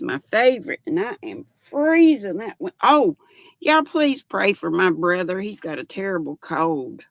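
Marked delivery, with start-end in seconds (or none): none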